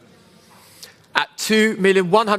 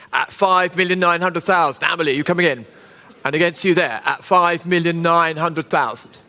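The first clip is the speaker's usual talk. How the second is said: auctioneer; 3 semitones lower than usual. Real, not acted. Live auction bid calling.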